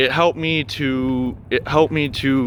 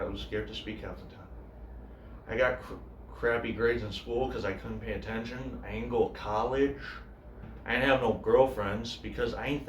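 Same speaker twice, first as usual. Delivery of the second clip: grieving brother's monologue; 2 semitones lower, 15 dB quieter than usual. Acted. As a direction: speak quietly and haltingly, as an addict's brother sharing. Monologue continues about the brother.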